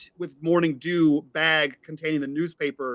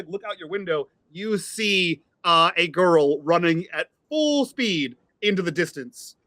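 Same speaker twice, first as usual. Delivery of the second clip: ordinary delivery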